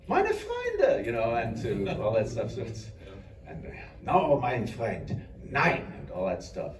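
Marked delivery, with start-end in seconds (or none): 0.1-1.0 lightly voiced German accent, pitched up; a quick German-accented Nightcrawler line; playful German-accent Nightcrawler quote. Quoting his Nightcrawler lines. 4.0-5.8 put-on slow German-accented line, a little higher; drawn-out German accent, Nightcrawler style; light German-accent Nightcrawler impression. Quoting his Nightcrawler lines.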